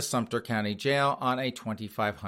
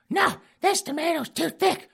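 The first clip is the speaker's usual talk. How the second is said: put-on high squeaky old lady griping; falsetto wife complaining. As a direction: whine it in a granny falsetto. Wife voiced arguing.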